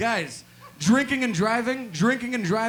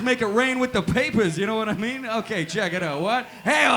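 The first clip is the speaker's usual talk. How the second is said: ordinary talk, nothing marked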